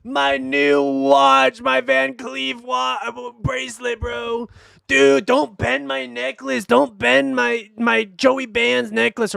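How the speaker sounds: high, slow, whiny imitation of the arrested guy; mocking whiny arrestee.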